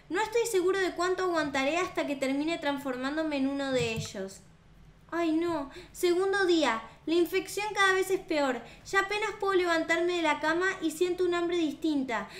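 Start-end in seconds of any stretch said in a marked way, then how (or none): none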